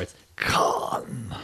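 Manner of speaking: hushed, drawn-out KHAN; emphatic Khan. Retold or imitated. Imitating the new movie's villain naming himself, emphatic. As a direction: breathe out "Khan" with heavy portent. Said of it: "he said it so, like, you know, emphatically".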